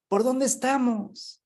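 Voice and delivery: high, stretched-out call for directions; mock-shout it down from a balloon; slow, high-pitched holler across a distance; shouted question. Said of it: "le empiezan a gritar".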